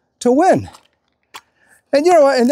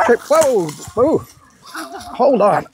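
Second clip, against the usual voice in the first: sudden real startle, pitch jumping up; a real surprised yelp on the dog walk; startled whoa; 3 semitones higher, slower than usual. Real, not acted. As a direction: caught off guard mid-sentence, blurting it out. Startled while out walking the dog.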